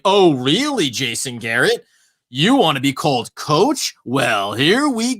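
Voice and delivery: exaggerated, drawn-out sarcastic questioning; mock incredulity. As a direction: imitate scoffing disbelief with swooping pitch. Mimicking media's mock-incredulous reaction on Twitter.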